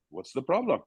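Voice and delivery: soft, unbothered and a touch detached; a manager too calm to care. Retold or imitated. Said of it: "He goes".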